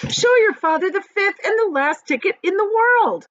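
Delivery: high, loud, thrilled cry; excited shout. Acted. Mother shows the ticket to father, thrilled. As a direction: burst out with the great news.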